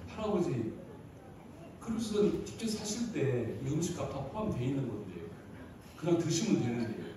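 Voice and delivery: low, soft manager's voice; quiet explaining. Acted. Manager telling grandpa the ticket includes meals, low and quiet.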